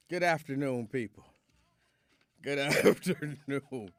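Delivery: greeting broken by a laugh; laughing through words. Real, not acted. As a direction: try to sound formal but crack up. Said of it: "I can't stop laughing".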